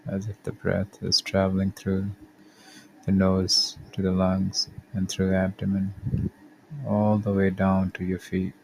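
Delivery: calm, measured body-scan guidance with long pauses; guided meditation. Acted. Podcast host guiding listeners through a body scan, calm meditation voice. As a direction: lead the listener gently through each step, unhurried.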